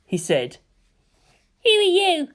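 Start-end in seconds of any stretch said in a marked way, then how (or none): 1.6-2.3 put-on high little kid voice; small child asking. A narrator's reading. A kid asks the stranger Toji, high childlike voice. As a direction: ask it like a curious small child. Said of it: "one of the kids asked".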